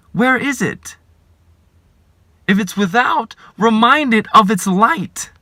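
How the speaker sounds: building sermon fervor; rising preaching. Acted.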